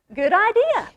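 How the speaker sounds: high bright good idea; excited agreement. Acted. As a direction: chime in eagerly together.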